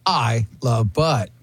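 slow, grave sign-off read; news anchor voice. Shown as a demonstration. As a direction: deliver it like a closing news line. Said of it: "The news anchor thing".

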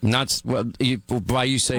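halting, broken false starts; flustered, restarting mid-phrase; stuttering. Real, not acted. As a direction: stumble over the start of the reply. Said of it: "Oh, you're stuttering".

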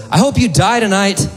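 high put-on curse at normal volume; imitated spiteful woman; homeless woman cursing. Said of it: "She goes".

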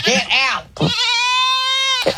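high, sharp command to an animal; shooing a goat. Acted. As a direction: shoo the goat out of the room. Shooing goats mid-call.

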